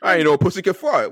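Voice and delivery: normal talking voice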